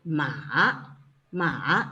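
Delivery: "Ma, ma" low and dipping. Shown as a demonstration. Teacher demonstrating third tone with ma. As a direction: drop "ma" low then let it lift. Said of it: "English thinking sound".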